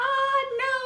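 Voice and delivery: very high squeaky cry; a high squeak of dismay. Retold or imitated. Reacting to her boyfriend's glare, high dismayed squeak.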